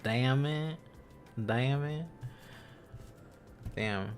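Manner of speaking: normal talking voice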